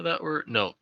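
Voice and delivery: ordinary delivery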